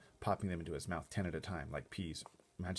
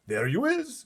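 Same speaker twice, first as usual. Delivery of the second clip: much higher, louder giant cry; 12 dB louder, slower than usual. Acted. Giant hearing the Sultan, high cry. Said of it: "cried the B F G".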